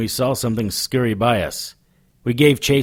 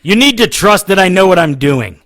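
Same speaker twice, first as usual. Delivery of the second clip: loud raised insistence; forceful retort; 8 semitones higher, 6 dB louder than usual. Acted. Harry cutting off Elizabeth's questions, raising his voice. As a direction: shut her down hard.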